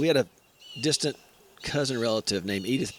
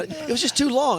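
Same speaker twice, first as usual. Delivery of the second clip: ordinary talk, nothing marked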